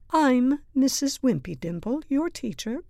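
high-pitched schoolteacher voice; teacher introduction. A narrator's reading. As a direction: introduce yourself grandly to the class. Teacher introduces herself to class.

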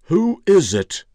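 loud, high, drawn-out demand; demanding old man. A narrator's reading. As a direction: call out crossly through the door. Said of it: "the old man's voice demanded".

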